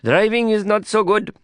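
put-on high-pitched Indian-accented friend, uneasy passenger; Prabhakar. A narrator's reading. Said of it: "Prabhakar replied".

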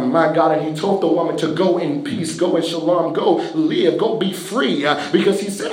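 ordinary talk, nothing marked

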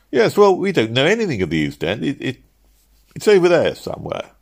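high-pitched, faltering clerk voice; flustered clerks. Acted. Clerks who know nothing of the East End, flustered. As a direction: fumble vaguely for an answer, pitched high. Clueless clerks fumbling an answer.

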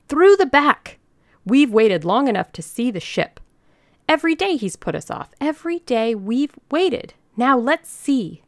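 high, loud mob-leader's harangue; rallying cry. A narrator's reading. Old lady leads the fed-up ladies to the ship, crying out. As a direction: rouse them, pitch way up, building indignation. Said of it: "cried Missus Bellows, feeling like Madame Lafarge".

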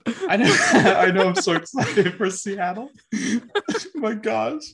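laughing. Real, not acted. Laughing after choked-up joke.

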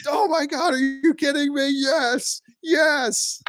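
very high, thrilled fan voice; mock overjoyed cheering; ecstatic call. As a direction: squeal with joy at the shot. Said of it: "I'd be like".

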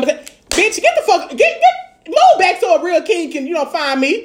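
high-pitched, sputtering send-off; scornful dismissal. Acted. Telling an unsure suitor to move back, high scornful dismissal. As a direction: shoo him away in a squeaky, hammed-up pitch.

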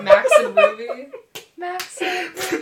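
laughing, high and loud; giggling out the title. Real, not acted.

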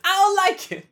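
high, loud comic squeal of complaint; jokey high-pitched tantrum; mock whining. Topping the silly complaint.

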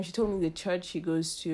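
ordinary delivery

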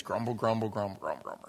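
muttered grumbling. Shown as a demonstration. Preacher demonstrating the sound of the word grumble. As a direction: mutter it under your breath like a grumble. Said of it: "That's what it sounds like".

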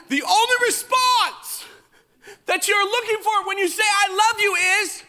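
high, loud, wailing complaint; hammed-up high-pitched protest; mock exasperated outburst. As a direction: cry it out in comic indignation. Mock outrage at wife's reply.

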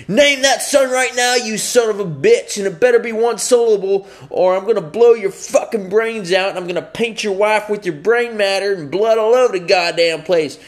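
loud, high, furious gunman's yell; threatening shout. Acted. Trench-coat freak at gunpoint orders father to name son, shouting threats. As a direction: scream it like an armed maniac.

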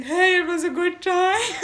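high, loud, put-on enthusiasm; fake cheerful. Woman reads out her text to a bad date, fake cheerful.

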